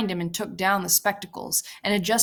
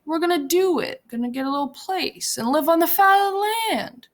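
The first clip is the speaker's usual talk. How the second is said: higher, earnest insistence; Lenny earnest; 10 semitones higher, 4 dB louder than usual. A narrator's reading. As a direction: insist on the dream like a child.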